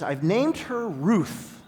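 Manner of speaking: slow, loud and firm, every word weighted; emphatic. Retold or imitated. Tearful vet insisting on the dog's name, emphatic. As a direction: announce the name like it's final. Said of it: "very emphatically".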